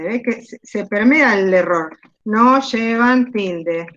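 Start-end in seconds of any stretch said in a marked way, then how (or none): 2.2-3.9 "no llevan tilde" very slowly, each word weighted; drawn-out, deliberate "no llevan tilde"; emphatic rule. Real, not acted. Teacher drills a rule emphatically.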